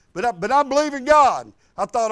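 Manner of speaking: usual speaking voice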